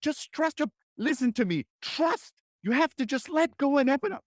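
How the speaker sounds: high, strained insistent urging; tense pushy urging. Retold or imitated. Someone tensely ordering a friend to relax, high strained pleading. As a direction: demand relaxation with total tension. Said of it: "but we're like".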